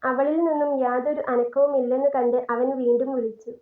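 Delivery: ordinary delivery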